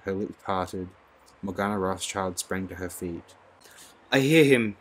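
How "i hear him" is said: high, loud cry of rapture; ecstatic cry. A narrator's reading. A worshipper leaps up hearing the coming, crying out. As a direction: cry it out, swept away. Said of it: "she cried".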